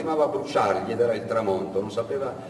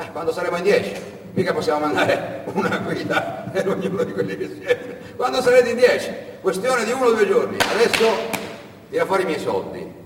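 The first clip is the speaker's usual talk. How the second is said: high, loud scoffing smuggler voice; the fat smuggler laughing at the boy; mocking smuggler; 6 semitones higher, 4 dB louder than usual. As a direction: sneer at him from up high.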